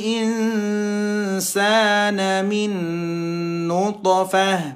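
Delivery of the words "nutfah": usual speaking voice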